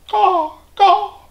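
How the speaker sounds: a toucan cawing for attention; squawking toucan. Acted. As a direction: squawk it like a big tropical bird. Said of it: "squawked the toucan".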